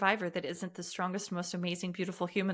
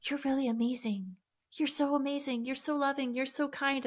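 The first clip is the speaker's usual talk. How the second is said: a higher, gentle inner voice giving praise; tiny inner voice; 6 semitones higher than usual. Retold or imitated. A child's inner voice praising herself, pitched up. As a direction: voice the kind little voice inside you, pitched up. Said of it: "this teeny tiny little voice inside".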